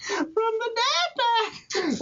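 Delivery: "From the dad pack" lifted high and stretched slowly; high repeat. Shown as a demonstration. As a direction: pitch the whole phrase way up, drawn out. Punchline phrase repeated.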